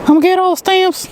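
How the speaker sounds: high-pitched, giddy excitement; excited squeal. Real, not acted. Vlogger delighted at collecting passport stamps, high excited squeal.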